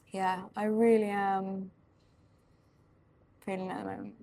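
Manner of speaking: talking while chewing gum; words round a wad of gum. Real, not acted. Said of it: "I've got chewing gum in my mouth".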